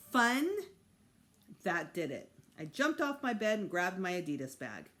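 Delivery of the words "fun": loud, high outraged echo; appalled kid repeating fun; incredulous. A narrator's reading. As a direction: throw the word back in disbelief. Outraged disbelief at mother.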